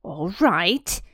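Dudley grudgingly recounting, face going red; sulky child. Acted. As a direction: give in with a pout, getting angry. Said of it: "said Dudley, going red in the face".

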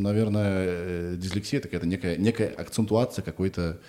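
usual speaking voice

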